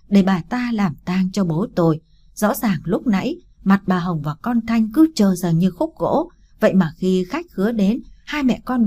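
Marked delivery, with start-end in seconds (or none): none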